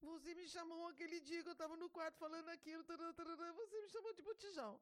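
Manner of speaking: put-on high, rushed child's complaint; retold tearful accusation. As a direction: mimic an upset daughter rattling off her grievance. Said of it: "Ela começou a chorar e falou assim".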